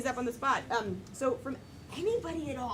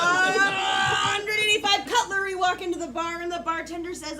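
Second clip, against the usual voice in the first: loud joke opener; 5 semitones higher, 7 dB louder than usual. Acted. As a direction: launch the joke at a shout. Player launches joke to crowd.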